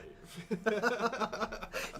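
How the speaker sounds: high breathy burst of laughter; caught-out giggle; real laugh. Real, not acted. Laughing at own slip.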